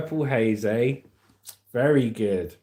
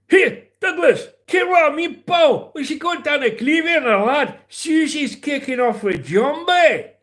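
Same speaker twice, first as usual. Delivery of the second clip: Scottish accent; 12 semitones higher, 4 dB louder than usual. Shown as a demonstration. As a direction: bellow like a comic Scot. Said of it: "don't get me started on the Scottish".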